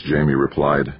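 ordinary delivery, nothing marked

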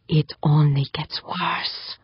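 slow, whispered threat; menacing hiss. A narrator's reading. Torturer to victim, into her ear, whispered hiss. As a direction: hiss it slowly under the breath. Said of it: "Kananga hissed into her ear".

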